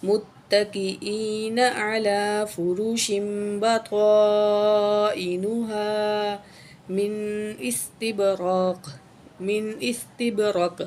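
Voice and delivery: slow, drawn-out tajweed recitation. Shown as a demonstration. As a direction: recite the complete verse with care. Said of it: "آیت مکمل کر کے پڑھتے ہیں".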